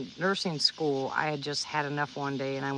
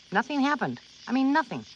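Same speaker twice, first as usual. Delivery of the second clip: amazed relief; 8 semitones higher than usual. Retold or imitated. Retelling her first panic attack that never came, amazed relief. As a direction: marvel that nothing happened at all.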